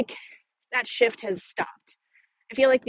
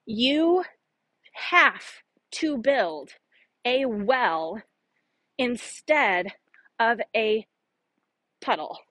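"You have to build a well instead of a puddle" with a pause after every word; slow word-by-word quote; much slower than usual. Shown as a demonstration. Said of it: "I think that's the quote".

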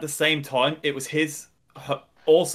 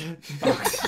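villain laugh. Pretend. Jar Jar evil laugh.

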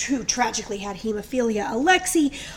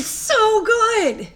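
savoring; 11 semitones higher, 4 dB louder, much slower than usual. Real, not acted. Savoring the chocolate drink.

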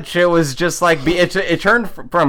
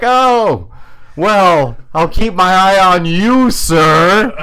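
voice cracking nervously; 3 semitones higher, 4 dB louder, slower than usual. Retold or imitated. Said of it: "as my voice cracked".

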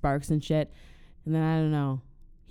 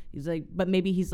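usual speaking voice

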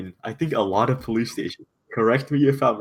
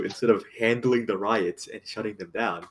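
usual speaking voice

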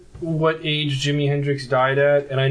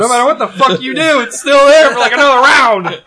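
exasperated laughing rant; 6 semitones higher, 7 dB louder than usual. Real, not acted. Complaining about jelly bean.

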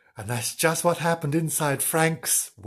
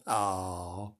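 plain reading voice

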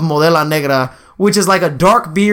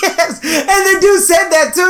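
much higher, squeaky with real laughter; laughing; 12 semitones higher than usual. Real, not acted. Agreeing with co-host about the insult, cracking up. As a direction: barely get it out for laughing.

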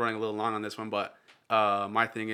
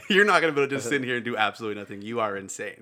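half-laughing stern mom voice; laughing through a strict mom's orders; laughing mock mom; 9 dB louder than usual.

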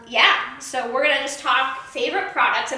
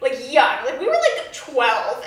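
ordinary delivery

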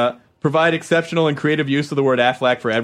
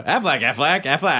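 duck voice. Shown as a demonstration.

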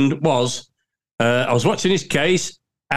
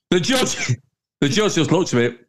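ordinary delivery

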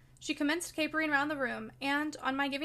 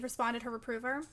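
usual speaking voice

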